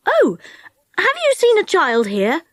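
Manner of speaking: high, polite little inquiry; politest voice. A narrator's reading. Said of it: "in his politest voice".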